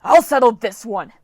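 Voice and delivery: loud furious scream; screaming spirit. Acted. Storm spirit Dylan screaming as he seizes Leo. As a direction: scream it as you grab him. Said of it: "but Dylan screamed".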